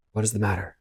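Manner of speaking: a soft, mildly nervous question; mildly quavering. Acted. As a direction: ask it quietly with a slight tremor. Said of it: "mildly quavering speech".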